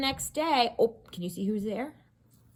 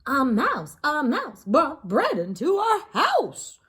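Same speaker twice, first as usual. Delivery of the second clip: higher, louder shout of the matchmaker's family; screaming; 7 semitones higher, 6 dB louder than usual. A narrator's reading. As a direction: yell it in alarm.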